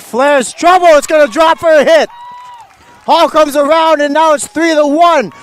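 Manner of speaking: high, loud, shouted call of the play; excited play-by-play. Real, not acted. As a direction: shout the error call as it unfolds.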